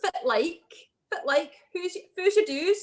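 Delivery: a quick Doric greeting from Aberdeen; lightly voiced Doric Scots; Doric accent. Shown as a demonstration. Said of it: "That's kinda Doric".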